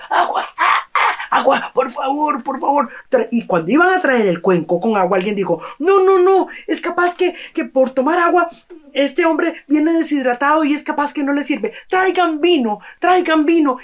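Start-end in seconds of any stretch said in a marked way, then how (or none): 0.1-2.9 much higher, gasping plea for water; dying of thirst. Acted. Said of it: "Y el hombre". 5.8-13.8 fussing objection. Acted. Another villager objects, wants wine.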